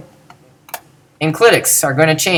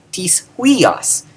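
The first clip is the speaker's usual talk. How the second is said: tis passed over lightly, huios raised high; tis left unstressed; 5 semitones higher than usual. Shown as a demonstration. Teacher showing tis before a noun, stress on huios. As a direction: lean on huios, not tis. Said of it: "Did I emphasize the tis?".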